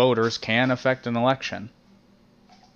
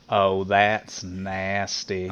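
very slow, lower drawn-out disgust; Cleveland impression; 4 semitones lower, much slower than usual. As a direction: stretch it out like a disgusted dad. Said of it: "the only thing I can do with his".

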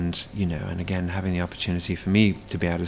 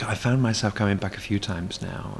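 normal talking voice

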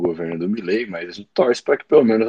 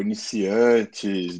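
normal talking voice

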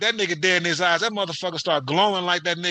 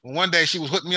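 usual speaking voice

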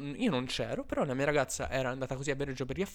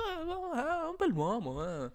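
put-on much higher, falsetto girlfriend insisting; mock girlfriend voice; 11 semitones higher than usual. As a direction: squeak it out like a dreamy girlfriend defending him. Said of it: "Ha detto". (fidanzata imitata con voce acutissima e sognante)

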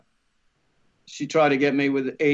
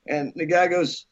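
normal talking voice

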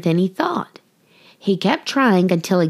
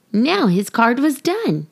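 ordinary delivery, nothing marked

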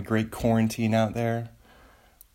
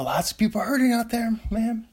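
much higher, fast joking voice; mock voice; 12 semitones higher than usual. Host poking fun mid-ramble, much higher and fast. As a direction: toss it off in a silly high voice. Sudden jokey high voice.

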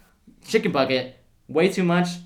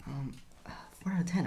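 hushed, guilty whisper of the score; 3 semitones lower, 13 dB quieter, faster than usual. Real, not acted. Reviewer sheepishly whispering a low score to co-host, hushed. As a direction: whisper it like a confession.

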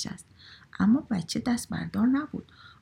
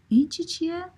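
put-on high-pitched pestering child; high child voice, curious and relentless; questioning child; 8 semitones higher than usual. A narrator's reading. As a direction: ask it high, like an insistent little kid.

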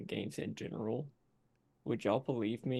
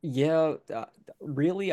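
ordinary talk

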